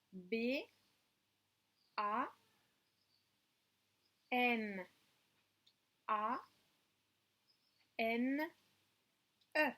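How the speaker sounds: "B", "A", "N", "A", "N", "E" named letter by letter with pauses; "B A N A N E" spelled one letter at a time; spelling aloud. Shown as a demonstration. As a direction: spell it out slowly for the class. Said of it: "avez-vous écrit le mot banane".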